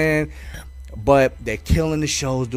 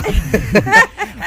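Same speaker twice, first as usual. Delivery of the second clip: loud high laughter; real laughter at the memory; 6 dB louder than usual. Real, not acted. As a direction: burst out laughing. Laughing at own story.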